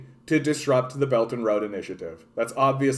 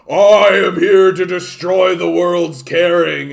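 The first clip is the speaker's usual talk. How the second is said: louder, slow villain declaration; 4 semitones higher, 8 dB louder, slower than usual. Retold or imitated. America as No Heart, a loud cartoon villain. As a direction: announce world domination like a cartoon villain. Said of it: "just being like".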